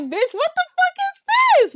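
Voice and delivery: squeaky high mock outrage at the ride; disappointed squeal. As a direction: pitch it way up in disbelief.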